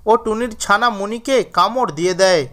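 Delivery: ordinary delivery, nothing marked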